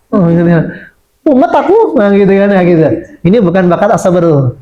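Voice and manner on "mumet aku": high, whiny mock complaint; whiny child. Anak mengeluh pusing. (suara anak merengek)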